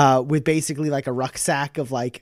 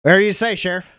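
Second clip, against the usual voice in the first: sarcastic agreement; 6 semitones higher, 6 dB louder, much faster than usual. Acted. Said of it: "And he's like".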